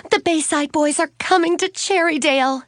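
high-pitched excited outburst; blurting out. A narrator's reading. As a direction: burst out with the big news. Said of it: "Suzanne blurted out".

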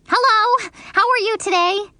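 high, sweet, loud greeting; very pleasant. Acted. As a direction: put on the nicest manners. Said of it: "I said, very pleasant".

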